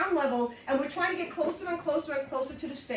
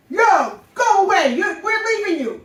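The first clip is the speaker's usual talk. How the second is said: loud, higher callout across a parking lot; mock shout; 6 semitones higher, 13 dB louder than usual. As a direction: shout them off as you leave. [name] ditching them.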